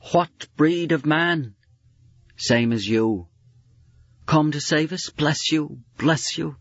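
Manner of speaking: high, strained call in the storm; frightened sealer. Acted. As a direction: plead into the wind. Frightened frozen man calling out.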